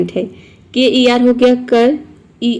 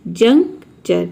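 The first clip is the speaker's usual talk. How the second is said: slow demo word; 3 semitones lower, much slower than usual. Shown as a demonstration. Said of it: "एक साथ juncture".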